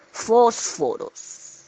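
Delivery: "Fósforos" alargado y muy lento. Shown as a demonstration. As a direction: dilo despacio: "Fósforos". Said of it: "la palabra fósforos". (pronunciación lenta y marcada)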